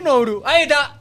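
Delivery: shouting. Real, not acted. Co-host shouting the winner's name.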